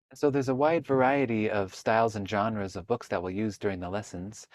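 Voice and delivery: ordinary talk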